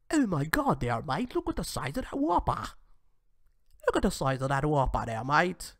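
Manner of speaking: hammed-up Australian accent, swooping in pitch. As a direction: play an amazed Aussie, putting the accent on thick. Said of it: "I can do the Australian accent very well".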